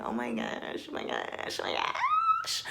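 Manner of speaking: whispered oh my gosh; breathless gushing. Real, not acted. Gushing over Lauren and Cameron, breathless whisper. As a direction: breathe it out in awe.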